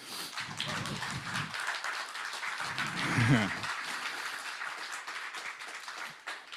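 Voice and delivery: quiet, nearly silent laugh; soft laugh. Real, not acted. Speaker pausing before thanking people, soft laugh. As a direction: let a soft laugh out to steady yourself.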